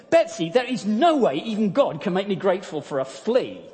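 loud, exasperated outburst; indignant protest. Acted. Corrie cutting in on her sister, indignant. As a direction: refuse it flatly and loudly. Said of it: "She cut in on her sister".

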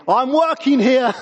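loud, high, drawn-out mock protest; passive-aggressive shout. Washer-up clanging pans at idle siblings, passive-aggressive holler. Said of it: "the clanging pans really loudly. I'm saying".

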